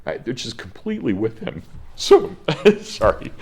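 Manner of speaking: moved teacher whose voice keeps jumping up and cracking; voice breaking. Real, not acted. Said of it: "I sound like I'm going through puberty".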